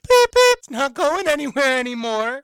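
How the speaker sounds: loud, high-pitched mock voice; hammed-up high impression, starting very loud and easing off; wide-eyed imitation. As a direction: squeal it out like a wide-eyed contestant. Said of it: "when she's like".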